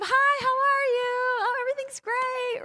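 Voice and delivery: high sing-song put-on cheer; fake cheerful greeting. Mocking the fake church smile, sing-song and high. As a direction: chirp it like everything's fine. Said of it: "Instead of".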